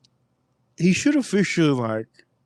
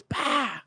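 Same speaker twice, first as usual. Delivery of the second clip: startled exclamation; 12 semitones higher, 9 dB quieter than usual. Retold or imitated. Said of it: "He goes".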